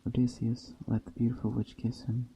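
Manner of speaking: ordinary delivery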